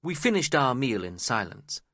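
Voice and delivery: ordinary delivery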